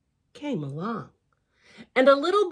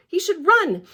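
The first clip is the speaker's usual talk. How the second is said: urgent call; 6 semitones higher, 6 dB louder than usual. Acted. Urgent worry, louder.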